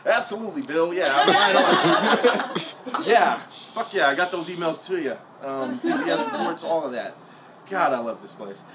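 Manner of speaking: mock-eager office employee; work voice. Employee agreeing with boss Bill, put-on work voice. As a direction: put on an upbeat yes-man work voice. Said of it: "your voice, um, when you're at work".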